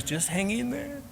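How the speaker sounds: high, soft, slow wife voice; gently drawn-out mock reassurance; imitated consoling wife. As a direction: pitch up and soothe slowly, as an imitated wife. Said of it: "She goes".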